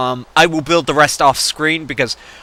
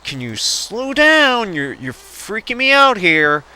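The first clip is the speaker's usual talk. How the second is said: pleading; 7 semitones higher, slower than usual. Retold or imitated. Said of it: "and I'm like".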